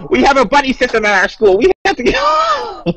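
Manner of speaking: high-pitched, hammed-up urgency; mock schoolkid panic. Joining the joke about a school fire drill, mock panic. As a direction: play a fretting pupil, voice shooting way up.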